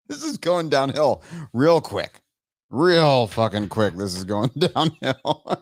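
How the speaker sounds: slow words through helpless laughter; laughing. Real, not acted. Streamer declaring the show doomed, laughing. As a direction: drag it out while cracking up.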